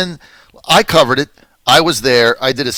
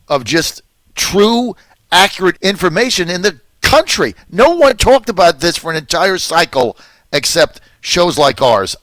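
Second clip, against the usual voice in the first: ordinary delivery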